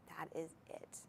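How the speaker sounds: breathy unvoiced whisper, very soft; whispered aside. Real, not acted. Host whispering the final word to viewers, hushed whisper. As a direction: let it slip out as a secret to the viewers.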